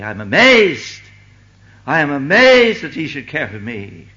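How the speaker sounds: loud, high burst of amazement; amazed exclamation. Acted. Preacher voicing a believer amazed at God's love, exclaimed loudly. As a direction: cry it out, stretching "amazed".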